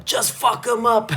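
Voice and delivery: very high, drawn-out mock shout; strained high heckle at moderate volume; imitated crowd yell. Imitating people in the crowd yelling at the fighter, high mock yell. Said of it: "when people yell".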